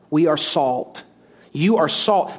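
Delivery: usual speaking voice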